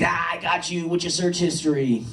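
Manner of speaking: put-on high, taunting kid voice; gloating student. Student catching out his teacher, high and gloating. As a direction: lift the voice and gloat.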